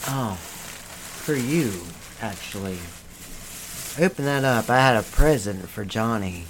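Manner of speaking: very low, soft voice; hushed gift-giving. Acted. Presenting a present to Johnny, hushed and low. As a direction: speak low and softly, unhurried.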